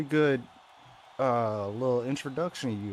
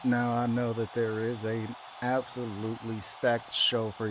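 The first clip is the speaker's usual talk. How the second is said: wrestling promo; 8 semitones lower, slower than usual. Acted. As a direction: address the arena like a wrestler.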